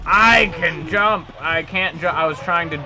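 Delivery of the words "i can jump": loud, slow, drawn-out boast; excited exclamation. Pretend. Player discovering the dinosaur can jump, excited exclamation. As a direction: stretch it out loudly in excitement. Exclaims over a game move.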